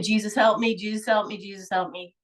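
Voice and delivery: normal talking voice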